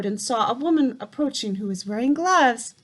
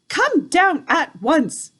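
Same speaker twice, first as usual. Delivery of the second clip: louder, higher, slow stern call; 6 semitones higher, 6 dB louder, slower than usual. A narrator's reading. Grandmother calls up the tree, firm and loud. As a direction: call him down from below. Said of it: "she called out".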